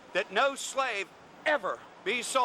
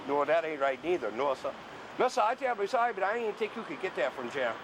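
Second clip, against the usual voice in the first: put-on Gullah accent; 2 semitones higher than usual. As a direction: put on a full Gullah accent, amused and polite.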